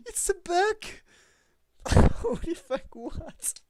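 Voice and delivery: a high, loud outraged squeal; shrieking disbelief. Real, not acted. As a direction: shriek at the screen. Shocked at the bug unit.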